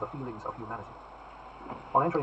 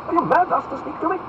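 outraged duke; 8 dB louder than usual. Acted. Buckingham voiced high.

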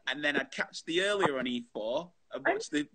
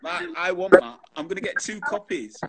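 excited; 12 semitones higher, 5 dB louder than usual. Real, not acted. Excited about Lego set.

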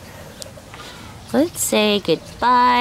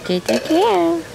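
high sing-song pet voice; teaching a parrot; 8 semitones higher, 4 dB quieter than usual. Real, not acted. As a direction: coax the bird, high and sweet. Coaxing a parrot to talk.

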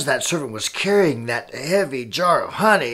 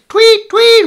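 high, loud shrieking tweets; police whistle noise; 9 dB louder than usual. Acted. Police are called to the fight, high loud whistle tweets. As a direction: imitate a whistle being blown.

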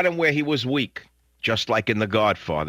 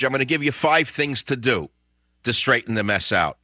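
normal talking voice

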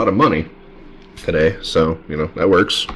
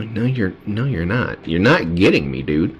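ordinary talk, nothing marked

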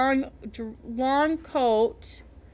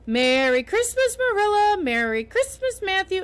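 bright joyful calls, names ringing out loud; excited greeting; 8 semitones higher, 5 dB louder than usual. Acted. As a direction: call out Christmas greetings with delight. Anne greets family Christmas morning.

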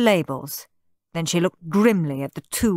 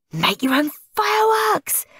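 beaming; 13 semitones higher than usual. A narrator's reading. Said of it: "beamed Henry".

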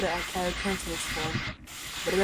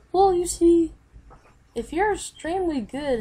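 character voice; 9 semitones higher, 6 dB louder than usual. Acted. Character starts explaining.

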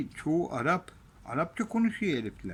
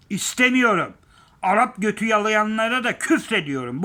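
loud angry insistence; 6 semitones higher, 7 dB louder than usual. Real, not acted. Vlogger refusing outsiders, loud angry insistence. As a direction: raise the voice and hammer it.

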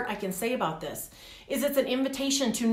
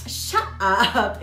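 "Shut up" with SHUT punched, then a break before "up"; catchphrase shut up; 4 dB louder than usual. Retold or imitated. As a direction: say "Shut up" like a pop-culture catchphrase, hitting "Shut". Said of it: "It was like this".

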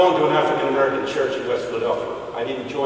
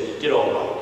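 put-on old pastor's understated praise; 6 semitones lower than usual. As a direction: murmur grudging approval, low. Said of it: "My pastor hit my knee and he said".

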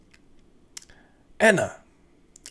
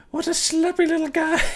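much higher polite woman's voice; woman client. Acted. Anna thanks the ghost hunter, much higher woman's voice. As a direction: play the relieved client, high and gracious.

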